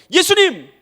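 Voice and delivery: high, loud call for help; acted shout. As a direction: call out to Jesus as if unable to see him. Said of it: "소리 지르는 것밖에 없죠". (높고 크게 외쳐 부르기)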